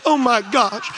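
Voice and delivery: high, loud self-righteous wail; self-righteous rant. Acted. Preacher mocking self-righteous churchgoer, loud high wail. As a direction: wail about how unfair God is.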